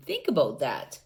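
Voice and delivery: plain reading voice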